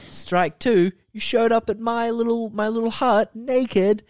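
high put-on voice as the husband; mocking Craig laying down the rules. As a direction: ham up a scolding husband, pitched high. Voicing Craig scolding Laurie.

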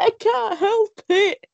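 high, slow mock whine; tearful protest, imitated. Crying film fan answering her sister, mock-tearful. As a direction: act out a helpless wail, drawn out.

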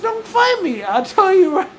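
high-pitched, worked-up threat; heated warning. Real, not acted. Tipsy podcaster repeating a warning to co-host, high and heated. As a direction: raise your pitch right up on the repeat.

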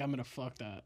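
a hushed, leering threat to the rug; mock creepy growl. Mock lustful thought about rug.

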